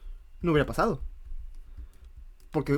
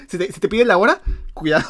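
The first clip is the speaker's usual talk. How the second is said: mock warning; 6 semitones higher, 8 dB louder, faster than usual. Joking warning, loud.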